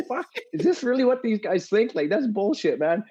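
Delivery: high-pitched mock outburst, imitating his own anger; fired-up outburst. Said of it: "I'm like".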